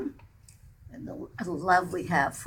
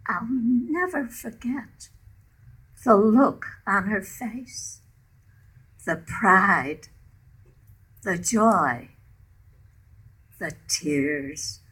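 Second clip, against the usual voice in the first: poem reading; 3 dB louder than usual. Acted. Poem narration continues.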